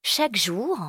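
plain reading voice

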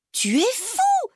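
high-pitched scolding exclamation; acted, high indignant cry; shocked outrage. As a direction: exclaim in horror at a brother's mischief. Sister shocked by brother's mischief.

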